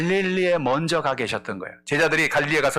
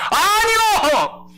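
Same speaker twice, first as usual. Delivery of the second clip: high, loud, drawn-out no; frightened denial; 5 dB louder, much slower than usual. Acted. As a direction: cry out the denial slowly in panic. Peter denies in fear. (높고 크게 길게 외치는 부정)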